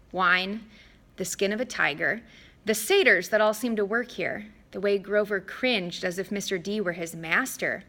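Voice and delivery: ordinary delivery, nothing marked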